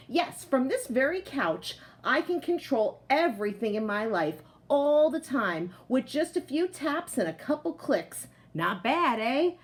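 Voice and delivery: lively picture-book voice for the potato; reading to children. A narrator's reading. Teacher reading a picture book aloud to her class, lively storytelling voice. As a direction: tell it like a proud, cozy couch potato.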